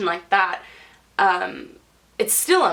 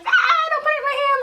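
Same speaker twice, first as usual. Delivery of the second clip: high, fast panicked squeal; hammed-up hysterical wail; mock screaming and crying; faster than usual. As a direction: play-act a shrieking fit. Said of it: "screaming and crying".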